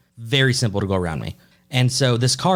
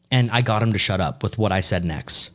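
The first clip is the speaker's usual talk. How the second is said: normal talking voice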